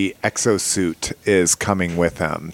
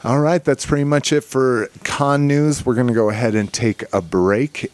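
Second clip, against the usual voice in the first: ordinary talk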